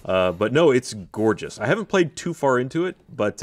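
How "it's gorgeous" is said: usual speaking voice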